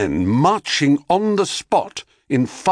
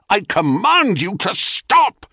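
yelled; 9 semitones higher than usual. Acted. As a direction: shout the order, frantic. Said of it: "yelled Cadmus".

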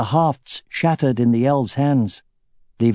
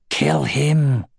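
growled. A narrator's reading. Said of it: "he growled".

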